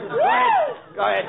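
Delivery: sudden high yell; shouting. Acted. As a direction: yell it out, exasperated. Director yelling at the actor.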